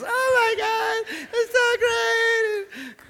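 high, slow, drawn-out mock rapture; exaggerated lovestruck delight; hammed-up gushing. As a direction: swoon over a date in a put-on squeal. Hammed-up memory of the date.

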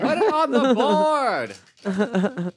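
high, drawn-out announcer-like cheer; celebratory call. Pretend. Player celebrates a hit, high triumphant call.

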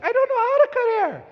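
high, loud mock wife voice; hammed-up high wife voice; imitating wife. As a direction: squeak it like his wife refusing. Husband voicing his wife.

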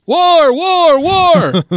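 high, loud chant of war; hammed-up war chant; mock war cry. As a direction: bellow it like a battle cry.

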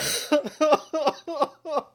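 high, loud burst of laughing; loud laughter. Real, not acted. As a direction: lose it completely. Laughing fit during intro.

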